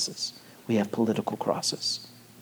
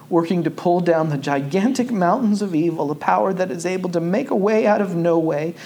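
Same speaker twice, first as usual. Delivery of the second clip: King quotation; 4 semitones higher than usual. Acted. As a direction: build the quote like a civil rights sermon. Quotes King at preaching pitch.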